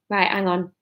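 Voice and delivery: plain reading voice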